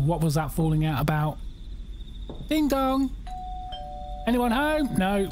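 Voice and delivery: normal talking voice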